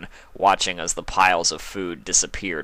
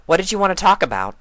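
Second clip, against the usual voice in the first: higher, quicker questioning voice; lightly voiced curious mare; Twilight's voice; 12 semitones higher, 6 dB louder, faster than usual. A narrator's reading. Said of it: "she said".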